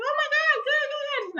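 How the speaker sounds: hugely high fake delight; a falsetto over-the-top "good, good, good"; mock high squeal. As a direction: squeak it out like forced joy. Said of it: "She was like".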